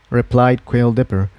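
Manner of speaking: usual speaking voice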